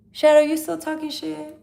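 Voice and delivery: loud challenge to a troll; loud call-out. Real, not acted. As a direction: call him out across the room. Calling out a troll.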